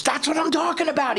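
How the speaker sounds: imitating a friend's high-pitched, thrilled yell; excited exclamation. Retold friend's excited approval.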